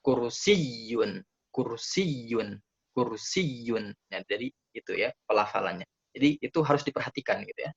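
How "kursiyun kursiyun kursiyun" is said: kur-SIYY-yun, held doubled y; stress on tasydid. Shown as a demonstration. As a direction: press and hold "siy" in kursiyun. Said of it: "tekan pelafalannya di huruf tasydid".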